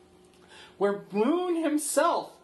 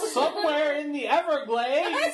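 higher laughing hostage plea; hostage mother voice; 8 semitones higher than usual. Pretend. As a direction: plead as a captive mother, laughing.

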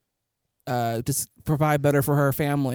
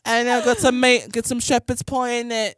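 higher-pitched mock Cockney; hammed-up Cockney accent; 9 semitones higher, 4 dB louder than usual. Shown as a demonstration. Host proves her point to co-host, exaggerated Cockney accent. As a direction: put on a cheesy Cockney. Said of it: "That's Cockney".

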